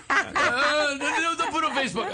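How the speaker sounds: high, fast, loud protest; panicked son imitation. Son begging his father, in a high panicked voice. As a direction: hurriedly plead as a mortified kid. Son's protest acted again.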